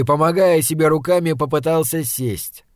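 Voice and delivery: ordinary delivery, nothing marked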